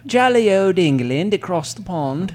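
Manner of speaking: hammed-up jolly English accent; cheery fake English accent; mock English accent. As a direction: put on a playful English accent. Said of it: "that's an amazing accent".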